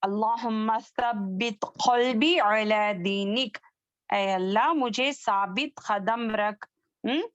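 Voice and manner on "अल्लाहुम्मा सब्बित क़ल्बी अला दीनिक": usual speaking voice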